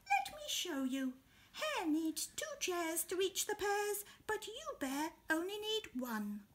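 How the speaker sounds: very high, small helpful voice; very high wise little beetle; tiny Beetle. Acted. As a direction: speak up from the ground, squeaky. Beetle explains fairness.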